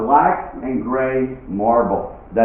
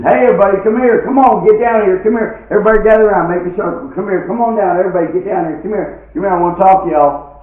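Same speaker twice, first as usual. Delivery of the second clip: big booming call to the crowd, higher and louder; 9 semitones higher, 5 dB louder, faster than usual. Retold or imitated. Father-in-law calling the party guests over, loud hollering. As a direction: holler everyone over like a host.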